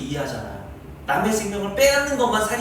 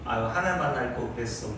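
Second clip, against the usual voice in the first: weary sigh; 6 semitones lower, 6 dB quieter than usual. Retold or imitated. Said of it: "이런 말도 하죠, 힘들 때".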